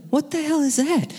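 high, startled mom voice; mom alarmed. Retold or imitated. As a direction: mimic mom's alarmed question. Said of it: "her to say, like".

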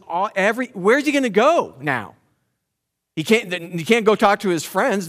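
rising preaching. Acted. Preacher building intensity as he asks the congregation where David can turn. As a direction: drive the questions home to the crowd.

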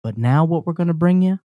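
mock concert announcer intro. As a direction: introduce the band like an emcee. Hosts a concert-style introduction.

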